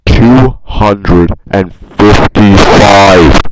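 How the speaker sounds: huge, very slow yell; drawn-out bellow. Acted. Vlogger hammering home his asset count, bellowing slowly. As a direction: bellow it, stretching every word.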